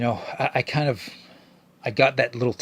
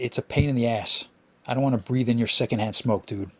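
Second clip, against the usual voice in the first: usual speaking voice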